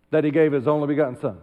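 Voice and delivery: fast, memorized rush; rattled off fast. Shown as a demonstration. Preacher mimicking church people rushing the memorized verse. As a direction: race through it like a verse you know by heart. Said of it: "We say it so fast".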